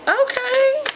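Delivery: okay jumps up high in shock; sudden disbelieving okay; stunned. Real, not acted. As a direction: react as if the gift can't be real. Shocked by the book in box.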